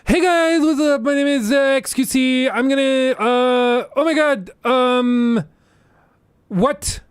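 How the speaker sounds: very high, loud, excitable streamer voice; XQC impression. Mocking XQC's stream, very high and loud impression. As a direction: shriek it like a hyper streamer losing it. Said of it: "his would be more like this".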